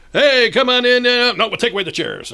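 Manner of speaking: high, loud mock welcome with flustered correction; calling out. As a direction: holler to arriving players. Acted calling out to guests.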